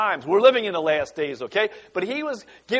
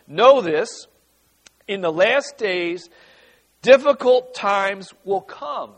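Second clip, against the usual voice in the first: slow and louder, solemn warning; scripture reading; 2 semitones higher, 5 dB louder, slower than usual. Acted. Pastor reading Paul's warning to the congregation. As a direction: read it out like a warning. Reading Second Timothy aloud.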